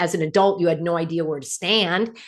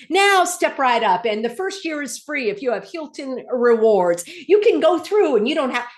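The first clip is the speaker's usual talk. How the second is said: huckster's sales pitch; 4 semitones higher than usual. Retold or imitated. Traveler imitating the man hawking airport Clear, huckster's pitch. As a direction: imitate a hawker drumming up trade. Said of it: "like, a huckster".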